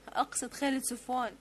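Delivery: ordinary delivery, nothing marked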